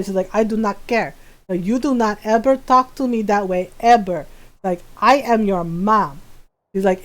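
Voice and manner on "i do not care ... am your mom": low, measured Filipino mom scolding; imitating stern mom. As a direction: play the mother pulling rank, voice dropped. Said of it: "she do my voice very well".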